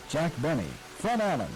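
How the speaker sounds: normal talking voice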